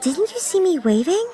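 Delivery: high-pitched reproachful question; miffed. A narrator's reading. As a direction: sound put out, pitched well up. Miffed friend reproaches her.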